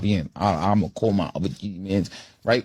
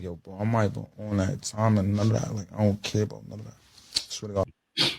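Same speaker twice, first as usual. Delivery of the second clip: backing down; 5 dB quieter than usual. Retold or imitated. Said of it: "backed up and was like".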